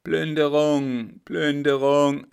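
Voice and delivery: loud, slow chant from below; mob shouting. A narrator's reading. The mob below calls for plunder, loud slow chant. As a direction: shout it like a crowd of looters.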